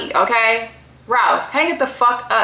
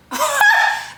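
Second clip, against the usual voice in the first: high squealing laugh. Real, not acted. Laughing at her own retort, squealing high.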